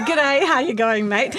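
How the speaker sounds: mock Australian greeting with a grin; Australian accent. Greeting the café staff, in a laughing Australian accent. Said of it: "Did you say, "G'day"?".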